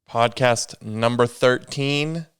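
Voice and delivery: ordinary talk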